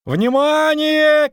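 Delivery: very high, long-drawn holler; loud stretched announcement shout; shouting from above. A narrator's reading. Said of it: "крикнул сверху Лютик".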